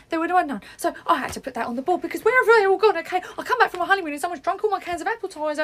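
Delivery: loud, fast, frazzled rant about the missing cans; stressed rant. Acted. Staff member rants to colleagues about stolen cans, loud frazzled rant.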